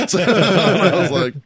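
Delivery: words squeezed out through a loud laugh. Real, not acted. Host laughing as he reaches the punchline, laughing loudly.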